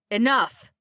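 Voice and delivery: furious shout. A narrator's reading. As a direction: shout down a rowdy room. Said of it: "he shouted".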